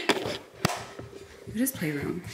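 ordinary delivery

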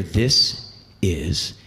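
slow, low and deliberate; bracing himself. Retold or imitated. Actor readies himself before the camera, slow and resolute. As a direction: drop low and space out each word.

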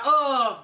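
loud, high disgusted ugh; disgusted groan. Real, not acted. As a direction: groan at the awful taste. Just ate rotten egg bean.

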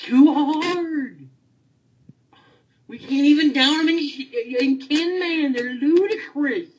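high, loud, exasperated outburst; furious rant. Real, not acted. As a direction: vent at the game makers at full volume. Ranting at Blizzard about raid.

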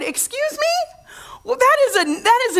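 high, astonished Excuse me; shocked disbelief. Retold or imitated. Pastor hearing she paid herself, stunned. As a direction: squeak it out in total disbelief. Shocked reaction to her check.

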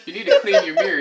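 giggling through a tease; laughing. Real, not acted. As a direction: laugh it out at Dad. Teasing dad, laughing.

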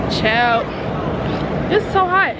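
ordinary talk, nothing marked

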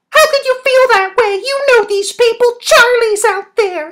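very high, loud outburst of revulsion; high-pitched, forceful reproach; appalled character voice. Acted. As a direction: plead at the top of the range, appalled.

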